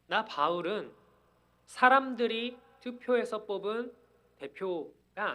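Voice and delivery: loud, emphatic proclamation as the apostle Paul; crying out. Acted. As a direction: proclaim it forcefully, pitch lifted on the key phrases. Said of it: "이렇게 외치고 있는 것입니다".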